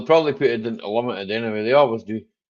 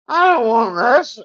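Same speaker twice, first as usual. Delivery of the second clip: mock exasperation; 10 semitones higher, 7 dB louder, slower than usual. Mock reaction to unwanted ad.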